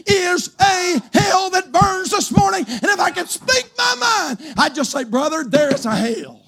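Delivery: high, driven pulpit preaching; full-force preaching. Real, not acted. Preacher warning the congregation of hell, full-force preaching. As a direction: preach it with long, leaning stresses.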